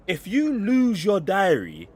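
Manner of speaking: slow, loud, hammered words; emphatic shout. Real, not acted. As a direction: drive each word home loudly. Ranting about lost diary.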